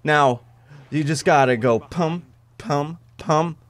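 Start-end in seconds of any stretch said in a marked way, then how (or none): 1.8-3.6 "pum" in separate beats, gaps between; spaced love taps. Shown as a demonstration. Gamer showing how to fire the gun, evenly spaced "pum"s. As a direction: say each "pum" alone, leaving a pause after it. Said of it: "give this thing love taps".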